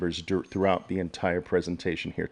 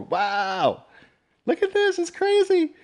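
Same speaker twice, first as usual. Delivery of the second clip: sudden very high, delighted squeal; excited squeal. Real, not acted. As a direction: squeal with real delight at the chat. Reacting to viewers' locations in chat.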